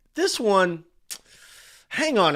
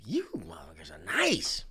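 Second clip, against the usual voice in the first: hushed, breathy mock confession; retold whisper of grudging praise; whispered aside; 3 semitones lower, 7 dB quieter than usual. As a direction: whisper it like a guilty secret. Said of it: "I was like".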